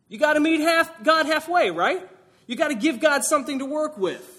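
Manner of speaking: high, loud mocking voice; mock bargaining. Preacher mocking Jonah's deal with God, high and loud. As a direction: ham up the self-righteous bargainer.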